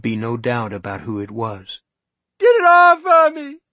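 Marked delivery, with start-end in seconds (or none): 2.4-3.6 desperate high scream of terror; terrified scream. Acted. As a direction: shriek it at the top of your lungs, high and loud. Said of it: "she screamed".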